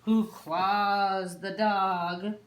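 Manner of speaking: plain reading voice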